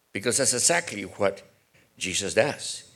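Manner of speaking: normal talking voice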